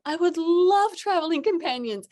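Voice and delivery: high, loud and thrilled; man happily accepting travel companions, high and loud; eager delight. Retold or imitated. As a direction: jump at the offer with glee.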